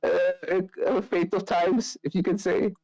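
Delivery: hesitate, holding back a snicker. Acted. Ismark joins in about the festival, snickering. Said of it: "snickers as well".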